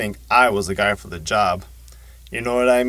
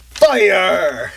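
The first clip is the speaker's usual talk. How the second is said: echoing fire; 6 semitones higher, 5 dB louder than usual. Acted. Dramatic emphasis on fire.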